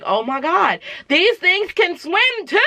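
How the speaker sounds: high-pitched re-enacted panic, stretched out; shocked disbelief. Moviegoer reliving the alien underwater reveal, shocked disbelief. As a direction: wail it in mock horror, rising high. Retelling shocked reaction in cinema.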